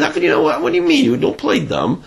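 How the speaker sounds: lightly voiced scolding bride; a hint of an exasperated wife; accusing wife.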